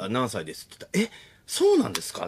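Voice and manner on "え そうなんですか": high-pitched, taken-aback question; surprised polite double-take, much higher; mock surprised reaction. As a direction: imitate someone startled by the answer. Acted reaction to hearing their age.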